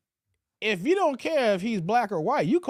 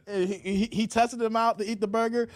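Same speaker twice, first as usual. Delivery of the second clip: ordinary talk, nothing marked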